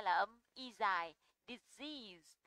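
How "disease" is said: "disease" with a long, stressed second syllable. Shown as a demonstration. Teacher repeats example for students, stress on second syllable. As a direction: model "disease", stressing the long vowel in EASE. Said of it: "Trọng âm bao giờ cũng rơi vào âm dài".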